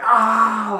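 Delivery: high, loud mock roar; acted furious holler; enraged yell. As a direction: let out a hammed-up scream of rage. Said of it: "just yells at him".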